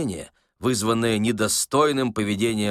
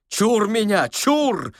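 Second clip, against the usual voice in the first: high, loud operatic outcry; Chaliapin-like exclamation; 11 semitones higher, 4 dB louder, much slower than usual. A narrator's reading. Old man fleeing his dream, theatrical operatic cry. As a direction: declaim it like a tragic opera star. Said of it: "воскликнул он с шаляпинскими интонациями в голосе".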